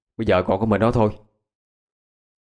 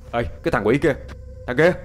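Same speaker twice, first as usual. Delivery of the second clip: higher-pitched cursing shout after someone; scolding call; 5 semitones higher than usual. A narrator's reading. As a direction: call out crossly to a friend down the road. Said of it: "cất tiếng chửi". (gọi với theo, mắng chửi)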